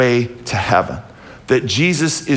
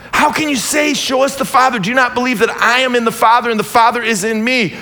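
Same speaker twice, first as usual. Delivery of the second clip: fiery preaching; 8 semitones higher than usual. Acted. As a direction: preach it out to the whole church, full force. Preaching at full force.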